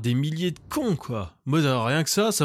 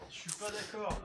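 faint voice away from the microphone; speaking from across the room; far from mic; 6 semitones higher, 16 dB quieter than usual. Real, not acted. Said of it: "je me balade dans mon appart, donc on m'entend peut-être pas bien".

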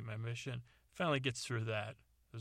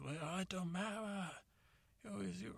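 high, soft put-on father voice; an old father grumbling; put-on dad voice, higher and softer; 8 semitones higher, 5 dB quieter than usual. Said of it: "he goes".

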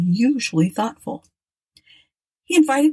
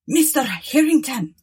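calling out; 7 semitones higher than usual. A narrator's reading. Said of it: "sang out the captain".

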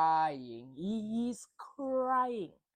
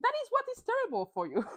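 much higher, mocking sarcastic reply; mock him with exaggerated surprise; sarcastic realization; 4 dB louder than usual. Vlogger sneering at Schwartz over his business, high and sarcastic. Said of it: "And I was like".

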